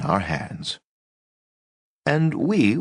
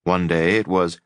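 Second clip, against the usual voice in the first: usual speaking voice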